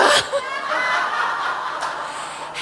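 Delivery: high breathless shriek of laughter. Retold or imitated. Retelling the drugged confession, a high shrieking laugh. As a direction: howl with laughter.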